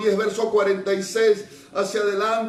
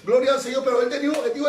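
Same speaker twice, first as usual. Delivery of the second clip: ordinary delivery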